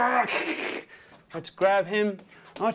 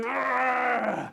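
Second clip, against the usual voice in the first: higher, sustained mock growl; long straining yell; 5 semitones higher than usual. Shrek grabbing the dragon's horns, long straining yell. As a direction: hold an acted effort cry.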